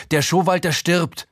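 höher und lauter, in Panik gerufen; Schrei. Acted. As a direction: ruft die anderen alarmiert herbei. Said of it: "schreie ich". (Erzähler ruft der Terrasse höher und laut panisch zu)

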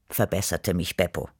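ordinary delivery

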